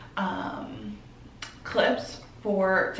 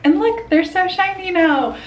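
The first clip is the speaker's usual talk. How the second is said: excited; 10 semitones higher, 8 dB louder than usual. Real, not acted. Showing off freshly whitened teeth.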